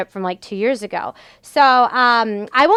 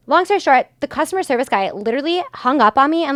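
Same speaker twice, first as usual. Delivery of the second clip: ordinary talk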